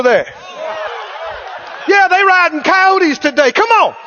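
high, taunting jeer with swagger; mock them like a cocky war horse; mocking attitude. War horse jeering at the enemy's horses, mocking. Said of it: "Mocking is attitude. With attitude, he goes".

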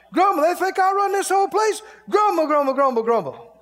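hammed-up muttered complaints; mock grumbling. Imitating complaining worker.

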